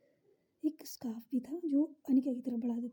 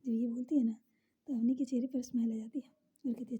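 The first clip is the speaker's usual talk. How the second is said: plain reading voice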